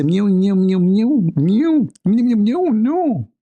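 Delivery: high, loud mock meows; a cornered cat meowing its way out of an answer. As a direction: meow like a cat caught out, pitched high. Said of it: "The cat say".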